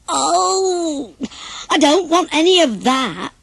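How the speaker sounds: high put-on pig wail of dismay; exclaiming in disgust. Pig refuses the castor oil, wailing exclamation. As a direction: recoil from the medicine. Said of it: "exclaimed Peter Pig".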